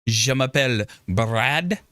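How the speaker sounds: slow, careful French, each syllable placed; French introduction. Shown as a demonstration. Streamer introducing himself to chat in slow put-on French. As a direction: introduce yourself in deliberate French. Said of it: "How is my French?".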